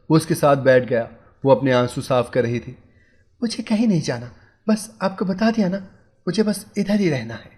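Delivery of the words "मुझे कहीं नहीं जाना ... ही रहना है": high, insistent, angry refusal; angry insistence. A narrator's reading. Wife angrily tells husband she stays here. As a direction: snap back that you're staying. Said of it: "वो गुस्से में कहने लगी".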